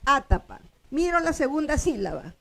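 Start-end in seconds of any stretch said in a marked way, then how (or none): 0.1-0.6 Atapa with the force on the opening "A"; stress on first syllable. Shown as a demonstration. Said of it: "yo acentúo la primera".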